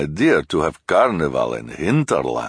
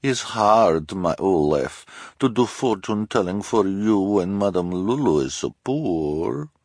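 put-on high, louder, pleading foreign accent; Madame Lulu's strange accent; 6 semitones higher, 4 dB louder, slower than usual. A narrator's reading. Fortune-teller pleads poverty, in a strange accent. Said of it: "said in her strange accent".

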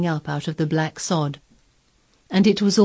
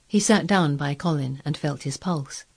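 ordinary delivery, nothing marked

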